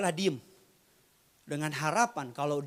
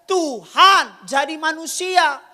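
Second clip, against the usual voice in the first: loud, high-pitched preacher's shout; full-force preaching; 17 dB louder than usual. Real, not acted. Preacher challenging doubters that God can become human, full-force shout. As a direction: fire rhetorical questions at the congregation, loud and high.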